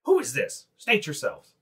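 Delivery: a guard barking an order at spearpoint; guard demand. Acted. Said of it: "It's like".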